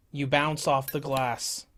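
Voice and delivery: normal talking voice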